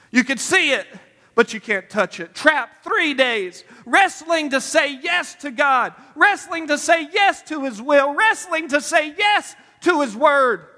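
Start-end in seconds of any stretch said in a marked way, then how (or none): 2.3-10.6 slow, rising preacher build; preaching cadence. Acted. Preacher stirring the congregation, slow insistent build. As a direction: press each wrestling line harder.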